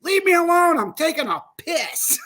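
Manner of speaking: very high, loud retort breaking into laughter; mock shout. Amber yelling from the bathroom on air, laughing shout. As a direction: shout it back while laughing. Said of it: "She goes".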